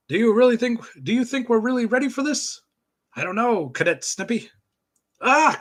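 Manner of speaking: hammed-up comic-book narration with character bits; cartoon narration. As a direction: sell each caption like a Saturday cartoon. Reads comic narration and voices.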